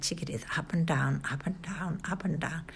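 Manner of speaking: soft unvoiced whisper. A narrator's reading. Father sneakily jiggling the line, soft whisper.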